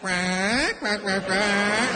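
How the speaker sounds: drawn-out, slow imitation quacks; female duck quacking. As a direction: quack slowly like a nagging mate. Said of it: "the female in the water is like".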